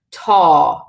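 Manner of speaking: "ta" drawn out as a long aw; long stressed A. Shown as a demonstration. Teacher showing what a fada does, long vowel. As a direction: lengthen and lean on the vowel of "ta". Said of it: "it makes it longer".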